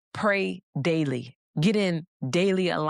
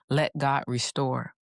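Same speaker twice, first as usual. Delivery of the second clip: ordinary talk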